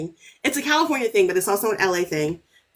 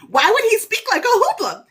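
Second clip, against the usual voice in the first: high, laughing question; laughing disbelief; 10 semitones higher, 3 dB louder than usual. Real, not acted. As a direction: laugh through the outrage. Incredulous rant about accent.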